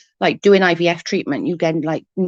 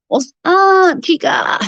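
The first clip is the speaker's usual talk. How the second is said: excited greeting; 10 semitones higher, 8 dB louder, slower than usual. Real, not acted. Greeting viewer joining live.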